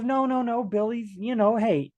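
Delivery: high mock-reassuring teacher voice. Teacher reassuring a parent about Billy, high and hasty. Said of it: "The teacher goes".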